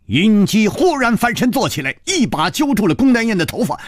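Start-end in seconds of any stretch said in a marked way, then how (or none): none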